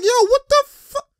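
high-pitched imitation of a stunned player; mock disbelief. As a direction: squeal it as the baffled opponent. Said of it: "he's like".

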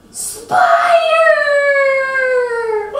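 teacher pretending to be startled by a spider; mock surprised shout. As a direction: cry it out in mock alarm. Finding a spider.

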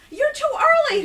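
high, very loud panicked shriek; frantic high-pitched yell. A narrator's reading. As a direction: shriek it in alarm. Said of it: "Herschel shrieked".

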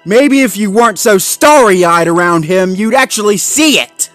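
loud, high, scornful shout; angry berating. Acted. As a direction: lash out at his naivety. Zuma berates Marshall.

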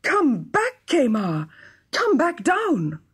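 high-pitched, drawn-out call; desperate calling. Acted. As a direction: call up to someone far overhead. King calls up to flying son.

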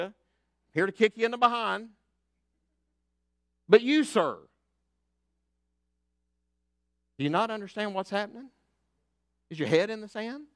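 loud, high, fervent preaching; preaching at full force. Acted. Pastor rallying his church, preaching loud and high. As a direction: lift them up with the message.

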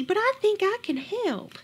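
put-on a high, cheerful old woman; brightly. A narrator's reading. Kind old woman politely offers help at the door, brightly. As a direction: pipe up brightly like a sweet grandma. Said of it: "she said brightly".

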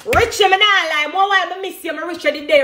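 high, eager call to Richie; excited shout-out. Real, not acted. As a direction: reach out to a dear friend. Calling out to her bestie.

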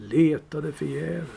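plain reading voice

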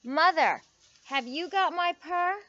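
calling out. A narrator's reading. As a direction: call out the question like an eager storybook kitten. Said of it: "to call out her question".

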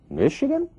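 "Michigan" very high, rising to a question; interrogative tone. Shown as a demonstration. Teacher showing the questioning tone on "Michigan", high rise. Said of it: "é um tom interrogativo aí".

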